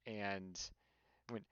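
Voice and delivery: normal talking voice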